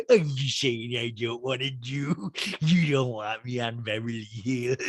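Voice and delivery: droopy-mouthed caricature of a reality star; Lisa Rinna. Acted. Reality star questions host about the show, slurred impression. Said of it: "it's so funny with the Rinna voice".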